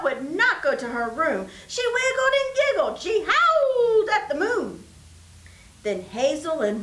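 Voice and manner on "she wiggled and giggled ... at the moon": giddy. A narrator's reading. Giddy story climax lines.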